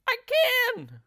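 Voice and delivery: high, loud excited cry; shrill squeal of delight from the old wife. Acted. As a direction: cry it out, thrilled to be stretched. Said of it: "cried Missus Twit".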